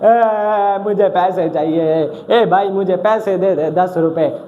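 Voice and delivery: mock-crying, drawn-out wail; crying bro. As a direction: whine for money like a bawling brother, hammed up. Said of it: "मेरा bro रोने लग गया".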